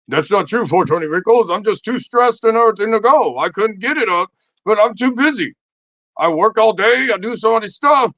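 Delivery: hammed-up husband making excuses; defensive husband. Acted defensive husband in therapy.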